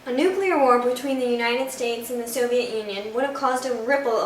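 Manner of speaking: booming announcer. Acted. Narrator booming the history lesson to the audience. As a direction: boom it out like a movie trailer.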